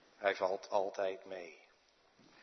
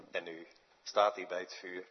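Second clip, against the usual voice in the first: usual speaking voice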